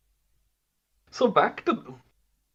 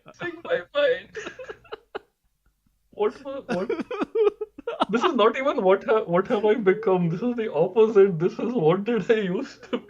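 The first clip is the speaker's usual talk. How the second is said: laughing disbelief; 9 semitones higher than usual. Real, not acted. Laughs at own past self.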